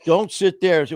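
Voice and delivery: forceful plea. Real, not acted. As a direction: urge it hard, like a plea to the parents.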